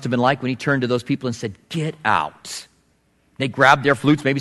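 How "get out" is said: stern command. Retold or imitated. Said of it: "the authority of Jesus comes out really strong".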